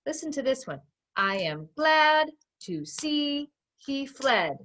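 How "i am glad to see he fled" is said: slow, each one-syllable word hit separately and higher; pounded out syllable by syllable. Shown as a demonstration. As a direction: beat out every word like a drum, slowly. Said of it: "I'm pounding out not only the words, but the syllables".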